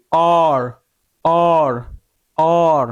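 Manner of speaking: অর held out with a round mouth. Shown as a demonstration. Teacher showing the mouth shape for O plus R, rounded অর. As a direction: round the lips and let each অর fall. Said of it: "কুল করতে হবে".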